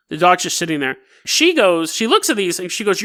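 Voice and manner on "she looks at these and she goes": ordinary talk, nothing marked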